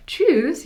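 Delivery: "tschüss" with a crisp German ü and a sharp tsch; high, bright German "tschüss". Shown as a demonstration. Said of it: "if we don't say".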